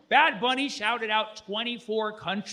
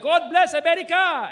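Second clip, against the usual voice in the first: triumphant shout; 11 semitones higher, 8 dB louder than usual. Retold or imitated. [name] shouting to the crowd, high triumphant yell. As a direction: belt it out high and loud.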